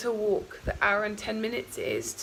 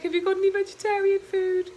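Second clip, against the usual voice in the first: much higher, louder eager question; high pitched asking; 11 semitones higher, 6 dB louder than usual. Retold or imitated. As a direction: ask high and loud, a bit desperate. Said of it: "I was like".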